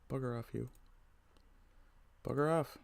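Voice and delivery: normal talking voice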